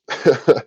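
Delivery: a quick burst of real laughter; short laugh. Real, not acted. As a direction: laugh at your own punchline. Laughs after the punchline.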